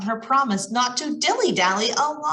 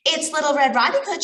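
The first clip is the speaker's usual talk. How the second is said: calling out; 7 semitones higher, 3 dB louder, faster than usual. Acted. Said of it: "she called".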